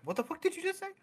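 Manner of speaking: put-on a parent hearing a swear from their kid; shocked parent. Parent reacting to kid, shocked and high.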